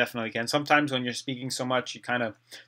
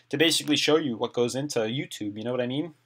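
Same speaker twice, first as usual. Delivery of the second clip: ordinary talk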